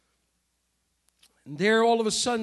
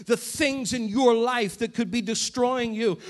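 ordinary talk, nothing marked

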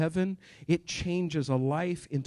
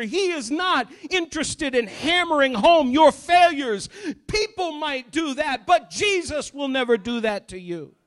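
louder, higher, driving sermon peak; full-force preaching; 6 semitones higher, 7 dB louder than usual. Acted. Preacher to congregation about Jesus' plan, full-force preaching. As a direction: preach it out, rising to a shout.

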